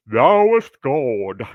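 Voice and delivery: loud, slow, stately intoning; hammed-up King James solemnity; mock pious prayer voice. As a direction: intone it like a pompous formal prayer. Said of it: "that's basically the way I talk".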